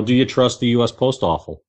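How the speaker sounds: ordinary talk, nothing marked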